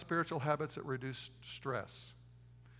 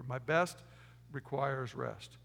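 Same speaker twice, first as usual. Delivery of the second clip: slow repeat; 2 semitones lower, much slower than usual. Shown as a demonstration. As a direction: split "My best" then slow "requires rest". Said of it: "Write that down".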